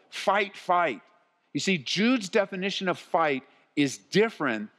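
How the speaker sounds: high-pitched, urgent preacher's delivery; impassioned preaching. Real, not acted. Pastor warning church about Christians not acting properly, impassioned. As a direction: preach it with mounting intensity, pitch climbing.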